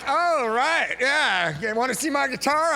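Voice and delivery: high, loud sarcastic mimicry; mock what she must have been thinking; mocking her thoughts. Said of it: "She was like".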